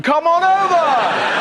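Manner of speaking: high, slow, loud drawn-out call; hammed-up invitation. Prince Harry inviting viewers, big slow call. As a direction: belt it like a showman. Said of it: "weekly show Prince Harry".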